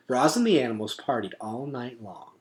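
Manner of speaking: ordinary delivery, nothing marked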